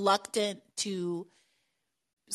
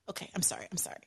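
sheepish apology; 2 semitones lower, 13 dB quieter, much faster than usual. Real, not acted. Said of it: "I'm being very unprofessional".

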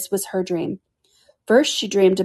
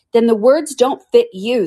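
smug; 5 semitones higher, 6 dB louder than usual. A narrator's reading. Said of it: "looking round the court with a smile".

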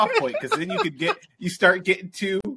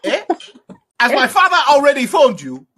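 high, loud, scolding mock African accent; generic African accent; 8 semitones higher, 6 dB louder than usual. Threatening to tell the father, mock African accent. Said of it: "generic African".

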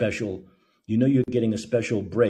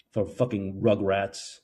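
ordinary talk, nothing marked

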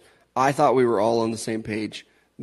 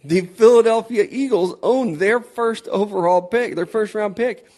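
much higher and louder, outraged; spluttering outrage; 10 semitones higher, 9 dB louder than usual. Real, not acted. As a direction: blurt it out in disbelief. Outraged reveal of pick.

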